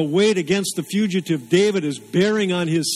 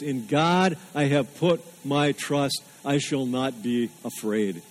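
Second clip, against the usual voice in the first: scripture reading; 2 semitones lower than usual. Acted. Reads Psalm 56 aloud.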